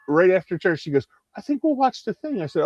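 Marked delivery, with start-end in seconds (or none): 1.4-2.4 mom's voice. Retold or imitated. Mom voiced in story.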